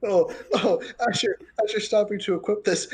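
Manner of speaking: ordinary talk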